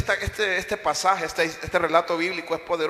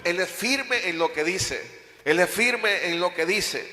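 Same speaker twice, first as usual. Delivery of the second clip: slow emphatic; slower than usual. Acted.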